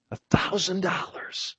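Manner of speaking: breathy awed whisper; hushed auctioneer; whispered bid. Acted. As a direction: breathe the sum out in awe.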